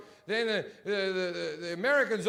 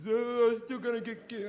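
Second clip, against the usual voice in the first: put-on naive kid voice; 5 semitones higher than usual. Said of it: "like a thirteen-year-old kid who still believes in Santa".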